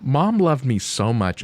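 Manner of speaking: normal talking voice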